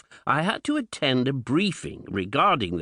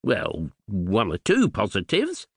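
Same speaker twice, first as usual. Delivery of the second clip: usual speaking voice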